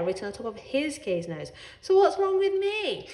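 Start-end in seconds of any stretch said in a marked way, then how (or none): 1.8-3.1 indignant. A narrator's reading. Cat's indignant protest.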